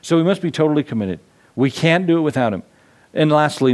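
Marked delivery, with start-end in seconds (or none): none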